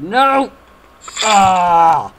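loud, high frustrated cry; frustrated yell. Real, not acted. Dies in same spot.

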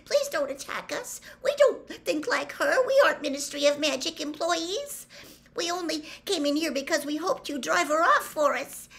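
high, urgent, breathless pleading. A narrator's reading. Hermione begs centaurs not to attack, breathless and high. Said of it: "said Hermione breathlessly".

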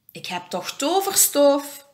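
high, gleeful exclamation; lively, mild storybook whoop. A narrator's reading. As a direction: crow it with the last word stretched. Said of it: "joelt Elfje Fien".

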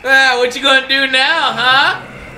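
high taunting, building to a shout; gloating taunt. Pretend. Gloating at zombies that cannot reach.

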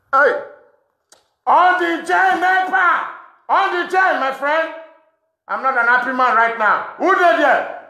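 loud high shout at the dark. Real, not acted. Shouting at nobody when the light cuts. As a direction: yell out about the light going off. Yelling at power cut, lights.

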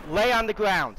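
angry shout. Acted. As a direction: yell it with full force. Cop yells order again, louder.

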